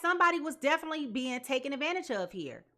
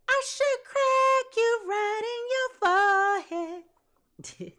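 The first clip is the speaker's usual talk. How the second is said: high, slow, louder threat; mock scream; 8 semitones higher, 5 dB louder, slower than usual. Reader imitating an angry woman screaming a threat, high and slow. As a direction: drag out the threat high and loud. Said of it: "hearing her scream in my ear".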